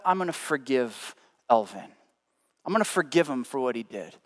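gently decide to forgive; quiet forgiving. Retold or imitated. The loving friend forgiving Alvin, softly. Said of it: "the loving side of you says".

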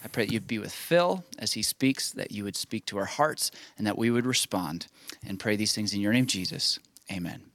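soft, slowing prayer voice; opening prayer. Acted. Pastor praying over the congregation before the guest speaks, softly. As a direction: pray it gently, settling the room.

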